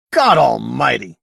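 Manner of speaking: loud startled outburst; exploding. Acted. Blaine exploding when the alien answers his call. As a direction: blurt it out, caught off guard. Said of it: "Blaine exploded".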